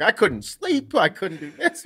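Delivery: high, loud imitation of wired dad; frantic caffeinated complaint, hammed up; jacked up. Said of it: "like, a little jacked up".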